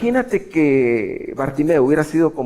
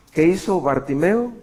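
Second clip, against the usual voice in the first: ordinary talk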